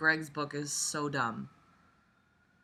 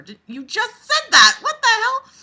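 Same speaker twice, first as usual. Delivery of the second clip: shocked; 14 dB louder than usual. Real, not acted. Shocked by the book's line.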